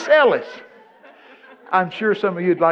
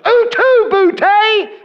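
much higher, louder and very slow theatrical declaim; hammed-up stage line; 12 dB louder, much slower than usual. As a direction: boom it out like a school play. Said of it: "the only line I ever learned was".

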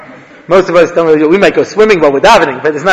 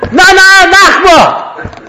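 loud, high shout of Na!; a high mock chant shout; 13 semitones higher, 5 dB louder, much slower than usual. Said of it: "And he says".

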